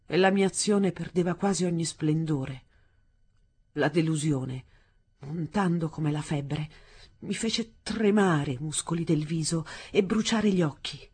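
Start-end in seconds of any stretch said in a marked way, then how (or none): none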